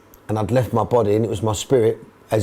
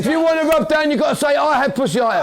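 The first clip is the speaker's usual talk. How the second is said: very high-pitched mock roll call; strained, high shout to a group; shouting to squad. Real, not acted. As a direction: holler it round the dressing room. Manager shouting instructions to squad.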